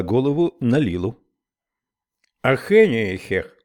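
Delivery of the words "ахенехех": mumbling through chewing gum; garbled words around gum; mouth full. Acted. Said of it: "Выплюнув комок жвачки".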